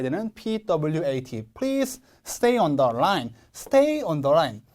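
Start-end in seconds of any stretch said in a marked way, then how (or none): 1.5-3.3 very slow, "Please" stressed, "stay" also stressed; strong PLEASE. Shown as a demonstration. Teacher models the sentence slowly, stressed "Please" and "stay". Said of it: "please가 너무 세기 때문에". 3.5-4.6 slow "Stay on the line" with Stay stressed. Shown as a demonstration. Teacher models the phrase, stressed "Stay". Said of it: "stay에도 적지 않게 강세가".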